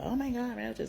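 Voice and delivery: high, lightly mocked disgust; mock a fussy person seeing roaches; mock squeamish lady. Mocking people who panic at roaches, high squeamish voice.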